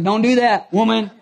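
acted husband's loud comeback; mock exasperation. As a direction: scold her in playful, hammed-up protest. Mock quarrel with his wife.

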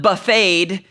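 buffeted said like buffet, as buf-FAYED; mispronounced buffeted. Shown as a demonstration. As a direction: say buffeted as "buh-FAYED", with a silent t. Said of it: "like they had a full course buffet".

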